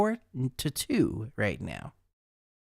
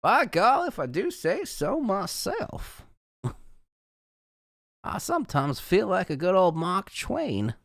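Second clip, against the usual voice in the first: hammed-up, high Southern gentleman accent; Charleston Southern accent; 5 semitones higher than usual. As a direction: play up a swooping old-time Charleston accent. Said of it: "like, Charleston accent".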